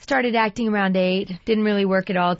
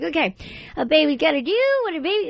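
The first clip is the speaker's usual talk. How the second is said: high, fast baby cartoon voice; 9 semitones higher, faster than usual. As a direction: rattle it off as a cartoon toddler. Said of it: "and then I'd be like".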